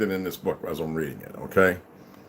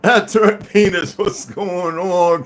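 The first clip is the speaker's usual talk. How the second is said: loud, slow, stretched-out hello; drawn-out greeting; 8 semitones higher, 7 dB louder, much slower than usual. Real, not acted.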